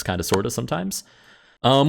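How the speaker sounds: ordinary talk, nothing marked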